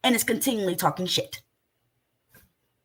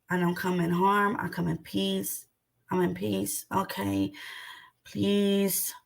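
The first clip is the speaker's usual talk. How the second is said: softer, lower, gentle voice for calming an animal; soothing calm; 5 semitones lower, 5 dB quieter, slower than usual. Retold or imitated. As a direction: ease in gently like approaching a wild animal. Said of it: "when you approach an animal, you have to let the animal know".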